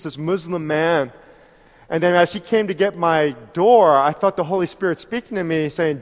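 ordinary talk, nothing marked

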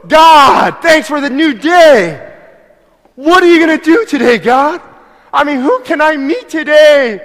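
preacher acting out waking up thrilled; excited morning prayer. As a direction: burst out with overflowing joy to God. Joyful morning prayer contrast.

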